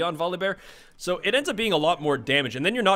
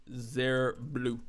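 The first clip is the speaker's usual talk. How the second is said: put-on German accent; 5 semitones lower, 10 dB quieter than usual. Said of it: "like I'm saying it with a German accent".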